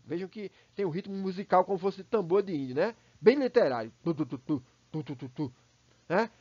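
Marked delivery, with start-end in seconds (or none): 4.0-5.5 soft low "tu tu tu" like a drum; drumbeat imitation. Shown as a demonstration. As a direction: tap out the rhythm with your voice. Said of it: "Uma imitação aí de, de tambor de índio". (imitação de tambor)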